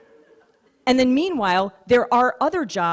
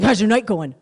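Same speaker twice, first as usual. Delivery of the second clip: gym bro; 4 semitones higher, 4 dB louder than usual. Retold or imitated. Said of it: "this is what he sounded like".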